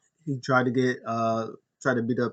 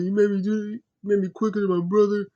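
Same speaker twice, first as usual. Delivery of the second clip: pleading Jey; 9 semitones higher, 4 dB louder than usual. Retold or imitated. Jey keeps pleading with Roman.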